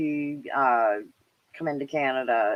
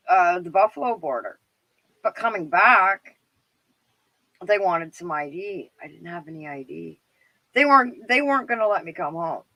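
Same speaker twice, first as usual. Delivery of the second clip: ordinary delivery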